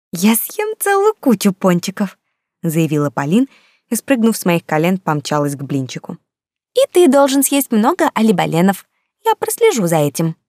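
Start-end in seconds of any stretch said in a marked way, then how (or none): 0.1-2.2 put-on higher, slow, determined child; a small girl making a grand vow; little girl voice. Little girl declares boldly. 6.8-10.4 put-on high bossy child to puppy; a little girl giving orders.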